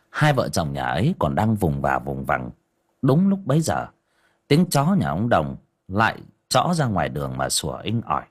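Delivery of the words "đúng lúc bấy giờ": ordinary delivery, nothing marked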